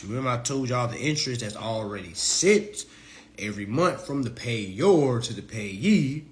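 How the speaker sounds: usual speaking voice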